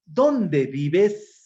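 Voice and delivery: loud question, DÓNDE punched and rising at the end; question intonation. Shown as a demonstration. Teacher shows class how question marks sound, emphatic questioning. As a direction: hit "Dónde" hard, lift "vives". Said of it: "la entonación que le da los signos de interrogación".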